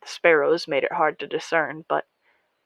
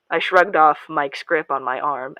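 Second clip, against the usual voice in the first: usual speaking voice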